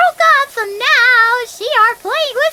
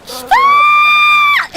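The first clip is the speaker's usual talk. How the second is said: high shrieking stop; yelled stop; 7 dB louder than usual. Real, not acted. Streamer screams at a fish thief in game, shrill.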